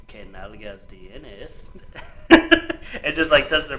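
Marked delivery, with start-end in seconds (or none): 0.1-1.7 slow, soft sly Spanish line; sly Spanish tease. Retold or imitated. Teasing a coworker from behind, slow and soft. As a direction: murmur it slowly, teasing behind their back. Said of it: "walk up behind them and go".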